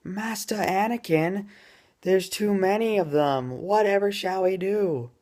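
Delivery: put-on high, squeaky British child voice; panicked little British youngling; posh kid voice. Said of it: "this is the best I could do".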